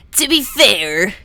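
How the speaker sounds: put-on character voice, slow and drawn out; Birdly. Streamer starts the copypasta as a game character, in a put-on voice. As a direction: draw it out in a silly cartoonish voice. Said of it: "I'm gonna do it in Birdly's voice".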